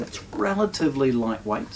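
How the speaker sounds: usual speaking voice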